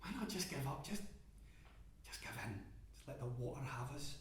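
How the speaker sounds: hushed, tempting voice in your own head; inner whisper. Acted. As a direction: breathe it to yourself like a temptation. Inner voice of giving up.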